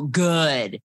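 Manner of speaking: ordinary talk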